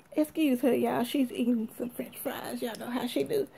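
whispered confidential aside to viewers; hushed aside. Real, not acted. Aside to viewers about child eating.

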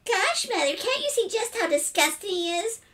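very high squeaky sing-song parody; mocking mimicry. A narrator's reading. Boy parroting his sister's complaint, high mocking mimicry. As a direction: mimic her in an exaggerated falsetto. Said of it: "mimicked Philip after his sister".

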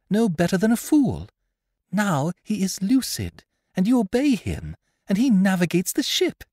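put-on high-pitched girl's voice, measured; girl, puzzled and probing. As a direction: press the gunner in a high voice. Voices the girl questioning the gunner.

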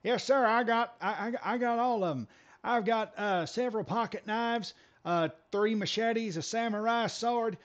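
hitchhiker's driver listing his weapons to the cops in a Southern twang; Larry the Cable Guy. Retold or imitated. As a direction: rattle off the arsenal like a proud country boy. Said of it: "is what he sounded like".

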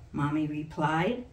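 plain reading voice

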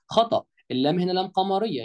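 usual speaking voice

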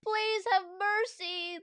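high, slow, pleading cry; crying plea. Acted. Boys plead with the principal, high crying plea. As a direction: beg for mercy. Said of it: "the boys cried".

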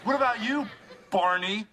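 indignant. Real, not acted. Indignant comeback.

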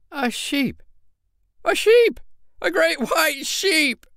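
high, loud and slow disbelief; the fear turns to a joke; astonished cry. Acted. Miller's son discovers the bear is a sheep, astonished. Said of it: "cried the miller's son".

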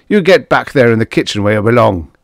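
loud, fast, angry order; bully her back to work; snarled. A narrator's reading. Father orders the girl into the kitchen, snarling. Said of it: "he snarled".

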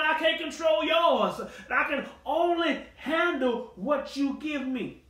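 high, loud shouted preaching; full-force preaching. Real, not acted. Preacher shouting at the saints to stop fussing, fervent. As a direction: drive it home to the congregation.

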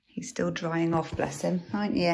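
ordinary talk

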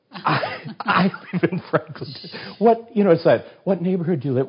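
high, astonished blurting at a coincidence; crazy excitement. Retold or imitated. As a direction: retell it giddy and incredulous, pitch way up. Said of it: "it got crazy like that".

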